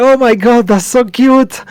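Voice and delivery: high loud squeal; excited squeal. Acted. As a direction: gush over something cute. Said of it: "she's like".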